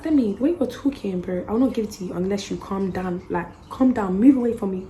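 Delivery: loud, firm scolding; loud telling off. Retold or imitated. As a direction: shout for her to calm down. Retold telling Demi off, loud.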